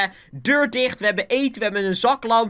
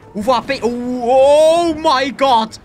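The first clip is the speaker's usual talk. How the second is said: loud, high, drawn-out "oh my god"; shocked cry; 6 semitones higher, 6 dB louder, slower than usual. Real, not acted. Gamer caught in a thunderstorm, shocked cry. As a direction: stretch it out in loud alarm.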